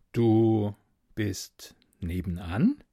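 slow breathy whisper, barely voiced. Man whispers to the voice behind the wall, slow whisper. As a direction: whisper it slowly, afraid to be heard.

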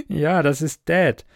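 short high acted laugh leading into speech; chuckle, then own up to it; amused chuckle. A narrator's reading. Grins, laughs about her father.

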